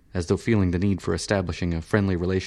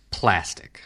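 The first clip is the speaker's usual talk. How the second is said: ordinary delivery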